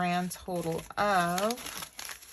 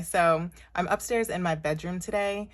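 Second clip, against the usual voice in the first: normal talking voice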